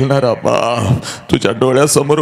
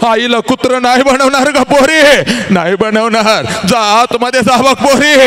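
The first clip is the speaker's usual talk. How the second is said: fervent preaching; 10 semitones higher than usual. Acted. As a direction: preach at full force, voice straining with emotion. Preaching at full force.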